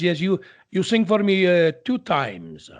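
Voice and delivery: put-on Italian-accented English, drawn out at the end; slow Italian-accented recollection. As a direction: imitate an Italian maestro's accent. Mimicking Pavarotti with Italian accent.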